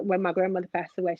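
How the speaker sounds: normal talking voice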